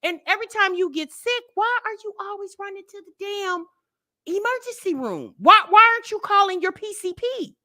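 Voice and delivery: normal talking voice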